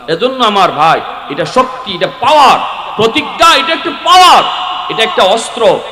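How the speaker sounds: high, loud preaching in short bursts; rally the congregation, punching key words; rousing exhortation. Real, not acted.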